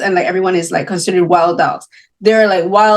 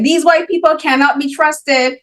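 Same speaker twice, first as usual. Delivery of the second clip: suspicious father; 6 semitones higher than usual. Retold or imitated.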